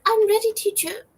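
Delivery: eager little student. Retold or imitated. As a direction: squeak it out like a keen little pupil in a bow tie. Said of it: "Be like".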